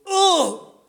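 a sudden high yelp as if shot; a dad fakes getting shot at a gunfight, high yelp; imitating a dad's yelp. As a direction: yelp like a man hit by a bullet. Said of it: "my dad go".

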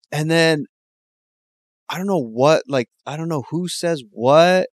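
ordinary talk

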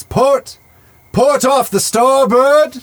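high, slow lookout call; shouting from crow's nest. Acted. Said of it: "he says".

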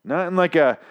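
ordinary talk, nothing marked

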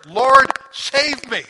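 desperate plea. Retold or imitated. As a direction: drag out a desperate cry while going under. Peter sinking, crying for help.

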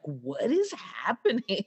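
mock bewilderment with a laugh; amused, acted confusion; confused baby. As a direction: wonder at the crazy grown-ups. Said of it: "They're so, like, confused".

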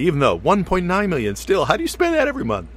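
high-pitched disbelief; incredulous, rising. Real, not acted. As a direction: voice climbs high in amazement. Disbelief at the money.

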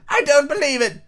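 very high, insistent bird voice; scornful pigeon. Acted. Pigeon calling Alice a serpent, high and scornful. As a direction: scold in a high squawk.

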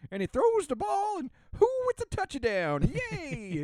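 put-on high, excited old miner announcing; old prospector. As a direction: call the play like a gold-rush miner. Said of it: "get an old prospector into just".

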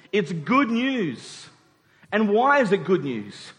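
ordinary talk

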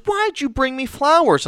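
wife's question. Acted. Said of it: "She goes".

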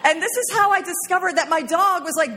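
high, giggling delivery; laughing through it. Real, not acted. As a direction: talk through real laughter. Laughing retelling funny discovery.

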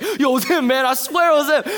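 high, loud mock pleading; imitating the guys blaming each other in a panic; mocking panicked roommates pointing fingers.

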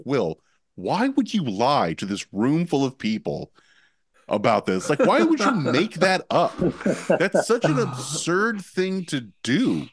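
lightly hammed-up fake indignation; mock innocent denial. Denying to the room, mock innocent indignation. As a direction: play innocent and accuse him. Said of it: "I was like".

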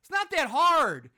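sharp high yell of disbelief at the screen; fed up, let the movie have it; exasperated outburst. Real, not acted. Reviewer yelling at the movie, exasperated.

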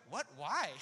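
blunt disbelief. Retold or imitated. One person reacting to the news, blunt disbelief. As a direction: imitate someone openly appalled. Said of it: "one person was straight up like".

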